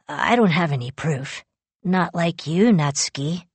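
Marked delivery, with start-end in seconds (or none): none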